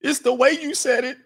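high mock complaint; mock whiny woman. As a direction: whine it like an offended caller. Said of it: "[name] was explaining it".

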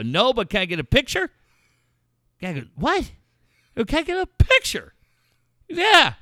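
loud, high, eager request; loud, high, gleeful asking; excited tourist. Retold or imitated. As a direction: beg for the photo with glee. Asking dealer for photo.

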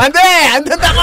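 high-pitched cry of protest; loud protest. Pretend. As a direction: shout it down in mock panic. Mock outburst at name change. (안 된다고 외치듯)

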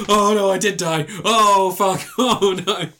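high, loud hammed-up horror; mock dismay. Dead man sees his body, high mock dismay. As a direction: gasp it out, laughing.

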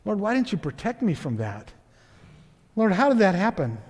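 soft, subdued plea; hushed questioning prayer. Acted. Spouse in an abusive marriage asking God why, hushed. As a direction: ask it almost privately, falling at the end.

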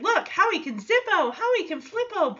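high singsong toy pitch; sing-song rhyme. Retold or imitated. Teen pitching a toy to a kid, high singsong. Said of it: "Deej is like".

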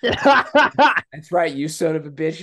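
laughing through a mock-tough line; laughing villain line. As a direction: try to stay tough but crack up. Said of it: "and he goes".

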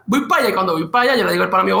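usual speaking voice

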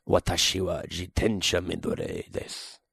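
deep, soft Japanese line; Japanese sentence. Shown as a demonstration. Showing off Japanese to a friend, in a low soft voice. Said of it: "Say something in Japanese".

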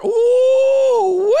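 ooh squealed very high and loud; piercing delighted squeal; excited whoop. Real, not acted. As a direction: let out a thrilled falsetto ooh. Hype reaction to the video.

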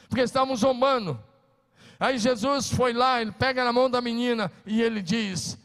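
loud, high preaching that builds to a shout; fiery preaching. Acted. Pastor preaching at full force.

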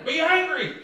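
Be angry, sung out high and bright as good news; high exclaimed reading. Shown as a demonstration. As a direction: throw "Be angry" up high like a discovery.

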